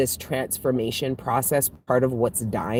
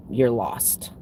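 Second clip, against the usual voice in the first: whispered tease. Retold or imitated. Scorpio season voiced teasing.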